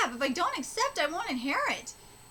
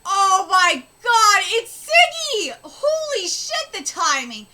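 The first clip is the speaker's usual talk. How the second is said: loud, drawn-out astonishment; excited exclamation; 7 dB louder, slower than usual. Acted. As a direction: cry out slowly in amazement. Villagers spot Siggy arriving.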